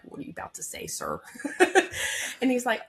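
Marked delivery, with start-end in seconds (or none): 0.0-1.2 wary whispered aside. Retold or imitated. 1.2-2.4 airy little laugh; breathy laugh. Real, not acted. Laugh alone on line.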